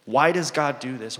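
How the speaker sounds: ordinary talk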